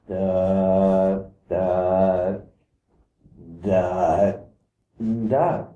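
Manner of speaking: a mild hint of a last heartbeat; dying vibration. Shown as a demonstration. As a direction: let each "the" sink as if life is running out. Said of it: "And then there's death".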